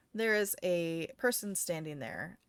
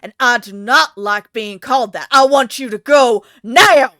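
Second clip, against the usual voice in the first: loud, building to a yell; angry woman; 3 semitones higher, 17 dB louder than usual. Acted. Woman in pink orders the stranger away, angry. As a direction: build to a furious command on "now". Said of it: "she looks so angry".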